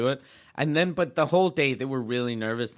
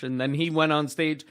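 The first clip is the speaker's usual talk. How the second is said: ordinary delivery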